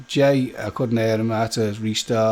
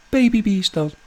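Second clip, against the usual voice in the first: put-on "Baby Beast" lifted higher, sing-song; cutesy Baby Beast; 8 semitones higher than usual. Shown as a demonstration. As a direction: coo it like talking to a pet.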